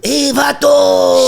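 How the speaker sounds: drawn-out hammed-up Chicano; mock Chicano accent.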